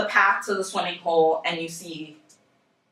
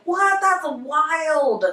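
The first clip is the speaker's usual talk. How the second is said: higher, mock-astonished what, that's wild; incredulous; 6 semitones higher than usual. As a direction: act baffled it happened again. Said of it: "you're like".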